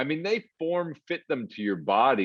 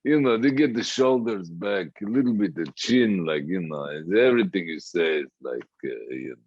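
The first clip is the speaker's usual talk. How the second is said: Russian accent, tapering lower as it goes; 5 semitones lower than usual. Shown as a demonstration. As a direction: demonstrate a loose Russian accent. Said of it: "how do I do that accent".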